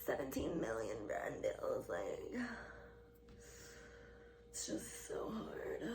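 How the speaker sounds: hushed, sighing fake self-pity; put-on exhausted lament; mock woe. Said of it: "to brag about and be like".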